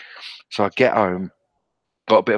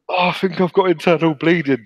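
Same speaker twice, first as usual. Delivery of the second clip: higher, hammed-up whimper of fake pain; faked injury; 8 semitones higher, 3 dB louder than usual. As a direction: pretend you're hurt to make him turn back. Faking injury to turn dad round.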